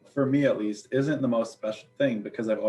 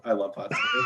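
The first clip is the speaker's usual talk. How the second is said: excited shout. Real, not acted. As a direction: blurt it out, thrilled. Gushing about favorite food.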